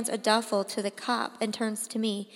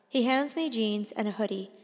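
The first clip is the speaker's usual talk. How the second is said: ordinary delivery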